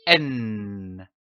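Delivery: a long hummed "N" held through the nose, pitch gliding down; sustained N sound. Shown as a demonstration. Teacher showing pupils the sound N, long humming "N". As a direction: hold "N" as a steady nasal hum for the class. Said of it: "pour voir la formation du son".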